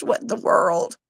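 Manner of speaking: whispered disbelief; bewildered whisper. Retold or imitated. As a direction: breathe it out in a whisper. Bewildered reaction to kids.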